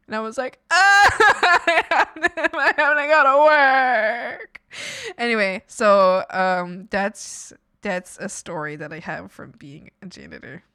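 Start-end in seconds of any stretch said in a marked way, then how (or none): 0.7-4.6 high, loud, slow laugh-whine; real laughing despair; laugh-crying. Real, not acted. Laugh-crying about parents.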